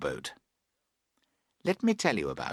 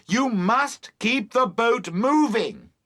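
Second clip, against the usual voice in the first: slow, emphatic raised voice; shouting; 11 semitones higher, 4 dB louder, slower than usual. A narrator's reading. Boatman instructing Emily, shouting. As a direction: shout it slowly, pitched up.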